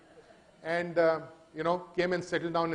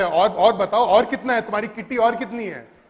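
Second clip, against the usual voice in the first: loud, quick mock interrogation; eagerly probing vendor; 3 semitones higher, 9 dB louder, much faster than usual. OEM digging for a partner's hidden kitty, eagerly probing. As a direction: press for more, louder and faster.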